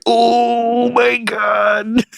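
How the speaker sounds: very drawn-out, high-pitched wail; mock dismay. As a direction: stretch every word in hammed-up horror. Acting out flashbacks, stretched-out groan.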